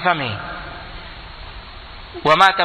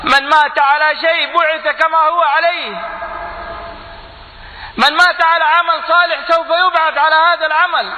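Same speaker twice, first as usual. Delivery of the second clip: full-force sermon cry, much higher; preaching at full force; 12 semitones higher than usual. Real, not acted. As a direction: proclaim it from the pulpit. Quotes hadith at full force.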